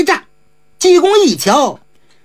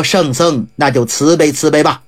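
lower, humble request; pleading squire; 10 semitones lower than usual. A narrator's reading. Squire asking the monk for help, lower. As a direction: ask for mercy politely.

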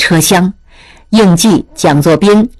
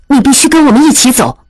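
higher, quick and commanding; firm 莲花; 7 semitones higher, much faster than usual. A narrator's reading. 莲花 stopping 应季, high and insistent. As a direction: block his way with a sharp order. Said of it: "莲花忽然挡在应季面前".

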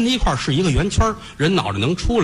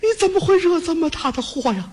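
tearful reproach; 13 semitones higher than usual. Acted. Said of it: "眼睛都哭肿了".

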